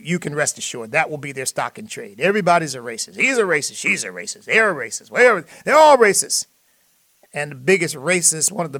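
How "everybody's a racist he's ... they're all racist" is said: escalating mock chant, jumping much higher and louder at the end; mocking accusation. Radio host mocking liberals, building to a loud sneer. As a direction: parody the finger-pointers, building to a sneering cry.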